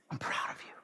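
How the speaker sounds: whispered, soft and close; tender whisper. Acted. Jesus whispering praise to a follower, tender. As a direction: breathe it like a private blessing.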